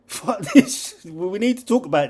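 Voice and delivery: high, loud laughing outburst. Real, not acted. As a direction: blurt it out laughing. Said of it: "I'll have to cut that".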